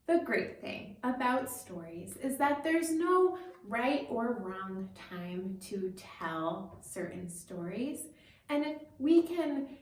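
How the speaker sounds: ordinary delivery, nothing marked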